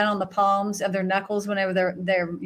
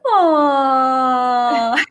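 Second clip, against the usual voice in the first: put-on cooing children; 4 semitones higher, 5 dB louder than usual. Children watching the gorilla rock her, cooing. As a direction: coo like kids at a cute sight.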